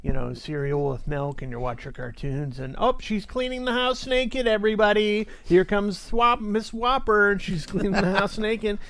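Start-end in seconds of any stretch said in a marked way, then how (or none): 2.8-8.8 higher, excited comic voice; excited standup delivery. Retold or imitated. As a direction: hype it up like a standup. Standup bit acted out.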